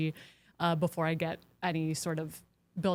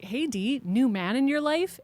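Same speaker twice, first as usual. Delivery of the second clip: bright, rising mock excitement, a bit louder; excited greeting; 6 semitones higher, 4 dB louder than usual. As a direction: retell a friend's eager teasing hello. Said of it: "he's all excited".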